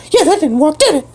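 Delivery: high fast bird voice; squeaky bird voice. Acted. Bird PD refuses help, high fast squeaky voice. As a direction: chirp it out fast.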